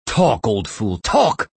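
insistent demand leaping high on the last word; angry shouted demand. A narrator's reading. Captor to prisoner on the verge of nausea, shouted demand. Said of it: "he shouted as if angry".

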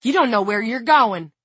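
high, loud complaint; bleating protest. A narrator's reading. Don protesting to the man who shoved him, bleating. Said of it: "Don bleated".

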